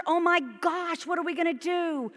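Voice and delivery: a hint of a fretting churchgoer wringing hands; imitating panicked worry. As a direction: ham up the worried congregant's panic. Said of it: "People worry about that".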